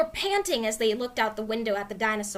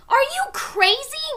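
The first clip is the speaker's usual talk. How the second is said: incredulous; 5 dB louder than usual. Acted. Boy aghast at sister's idea.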